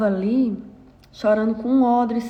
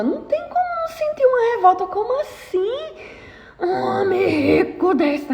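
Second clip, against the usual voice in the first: outraged disbelief; 10 semitones higher than usual. Acted. Preacher voices outraged disbelief.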